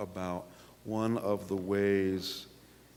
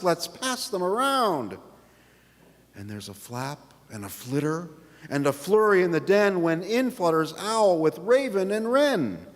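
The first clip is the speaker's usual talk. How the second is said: put-on lively read-aloud for children, pitched up; story narration; 9 semitones higher, 8 dB louder than usual. A narrator's reading. Pastor reading picture book to congregation, storytelling voice. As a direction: tell it bright and bouncy to the kids.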